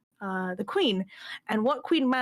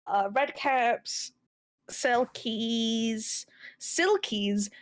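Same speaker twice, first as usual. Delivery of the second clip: usual speaking voice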